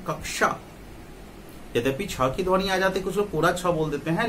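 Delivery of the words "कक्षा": कक्षा as kak-sha, both parts distinct; mid-word conjunct demo. Shown as a demonstration. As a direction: model कक्षा with the k held before sha. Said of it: "इसका उच्चारण करेंगे". (कक्षा का क्ष साफ़ बोलकर)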